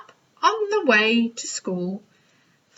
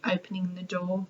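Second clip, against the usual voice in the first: ordinary delivery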